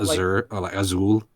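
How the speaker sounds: "azure" said a-ZUR, like "azul"; like Spanish azul. Shown as a demonstration. Reader explaining his version of the word, a-ZUR like azul. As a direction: stress the second syllable, "azure" like "azul". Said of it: "I think of the word".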